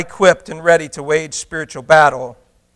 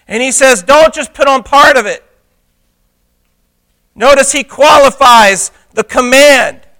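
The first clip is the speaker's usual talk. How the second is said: loud, higher-pitched sermon delivery; forceful preaching; 6 semitones higher, 9 dB louder than usual. Real, not acted. Preacher urging the church to put on the whole armor, forceful preaching. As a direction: drive it home at full pulpit volume.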